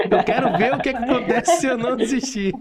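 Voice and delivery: laughter breaking into the motto; happy laughing recital; laughing while quoting. Real, not acted. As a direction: quote it through a real laugh. Host repeats guest's motto, laughing.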